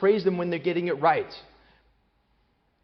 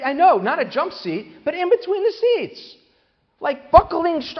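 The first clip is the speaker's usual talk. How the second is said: high, slow, disbelieving; incredulous; 12 semitones higher, 7 dB louder, slower than usual. Real, not acted. Speaker describing the attendant on the floor, high incredulous. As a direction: stretch it out in disbelief. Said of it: "incredulous retelling".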